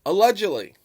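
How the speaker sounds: "Allegedly" punched loud and high, then falling; emphatic allegedly. Real, not acted. Vlogger stressing his fairness to viewers, emphatic allegedly. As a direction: lean hard on "Allegedly", pitch leaping up. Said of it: "emphasize the word allegedly".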